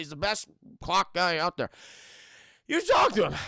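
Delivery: loud, laboured tipsy voice; drunk old friend. Retold or imitated. Drunk friend phoning Lara with a tip, loud tipsy voice. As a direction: play a hammy drunk on the phone. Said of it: "it's the drunk, uh, friend of her father".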